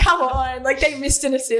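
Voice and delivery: high-pitched, amused complaint; laughing protest. Real, not acted. Co-host teasing about missed assists, laughing protest. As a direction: protest through real laughter.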